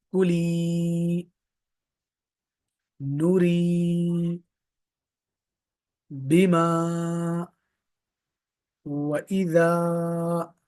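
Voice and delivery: each word read slowly with its long vowels held; slow, drawn-out reading of the line; stretched reading. Shown as a demonstration. Teacher rereading the line, long vowels stretched. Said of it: "दूसरी line फिर से पढ़ रहा हूं".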